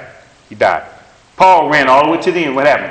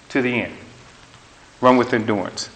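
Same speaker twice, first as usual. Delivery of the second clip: ordinary delivery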